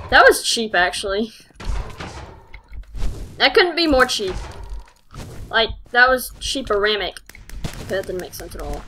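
ordinary talk

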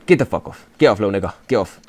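quiet, low dismissal; muted, irritated aside; low grumble. Real, not acted. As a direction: send him off under your breath. Grumbling at player being substituted.

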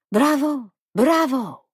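high, loud foreign "bravo"; theatrical applause. A narrator's reading. Foreign guest applauding the hostess's spirit, high and theatrical. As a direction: cheer with showy approval.

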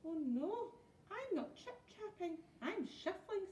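high, meek little troll voice; very small voice. A narrator's reading. Troll pleading with pirates on the plank, very small voice. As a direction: squeak out a timid correction. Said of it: "said the Troll in a very small voice".

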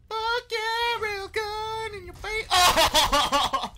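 laughing, shouting in triumph; excited shout. Real, not acted. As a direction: yell it out with a laugh. Big kill in the game.

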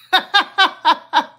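short bright laugh; amused laugh. Real, not acted. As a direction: laugh at yourself. Laughs about son doing hair.

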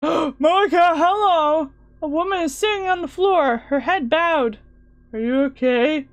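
calling out. Acted. Calling out in dark room.

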